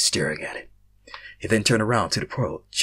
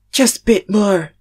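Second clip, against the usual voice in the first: higher, slow urgent woman's call; female runner; 11 semitones higher, 11 dB louder, slower than usual. Acted. Female runner urging the group on, high and urgent. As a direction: push the others on, higher voice. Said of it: "This one had a female voice".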